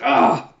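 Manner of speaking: short, high, loud "ah"; high-pitched yelp of dismay; startled cry. Acted. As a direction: cry out as the flag goes up. Acting Hinata's reaction.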